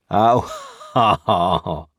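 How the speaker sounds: short gruff ha ha ha; a brief rough convict chuckle; gruff laugh. A narrator's reading. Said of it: "with something like a gruff laugh".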